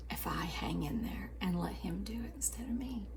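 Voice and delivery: soft whispered confession of trust. Shown as a demonstration. Declaring she'll let God do it, whispered.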